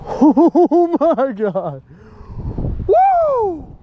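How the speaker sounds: slow, loud, high cry of joy breaking into laughter; ecstatic yell. Real, not acted. Angler celebrating a huge bass alone, laughing loud whoop. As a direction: whoop like you can't believe it.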